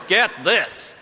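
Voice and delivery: punched out in a put-on American accent. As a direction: imitate an American driving the point home. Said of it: "I hope I haven't offended you if you're American".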